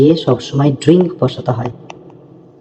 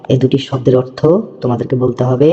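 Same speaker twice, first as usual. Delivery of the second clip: ordinary talk, nothing marked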